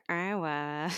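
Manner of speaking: usual speaking voice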